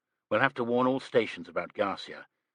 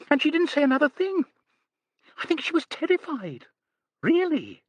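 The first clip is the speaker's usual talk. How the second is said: very high, fast, rushed telling; breathless gossip; 4 dB louder than usual. A narrator's reading. Miss Lee gushing the frightful scene to the police. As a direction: gush out the dreadful part.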